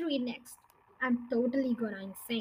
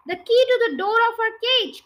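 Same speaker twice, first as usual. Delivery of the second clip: higher, louder eager cry; cried; 8 semitones higher, 9 dB louder than usual. Acted. Muggle Wump telling the bird from the cage, eager cry. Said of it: "cried Muggle Wump".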